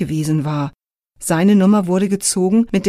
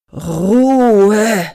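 louder, stretched stern "Ruhe"; drawn-out call for order; 2 semitones higher, 6 dB louder than usual. A narrator's reading. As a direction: stretch every letter, commanding. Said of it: "Dabei dehnte er die Buchstaben".

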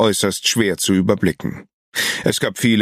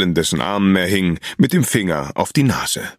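ordinary delivery

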